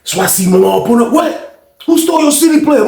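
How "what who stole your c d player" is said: high-pitched indignant question; outraged demand. Retold or imitated. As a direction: demand answers in a raised voice. Acted reaction to the theft.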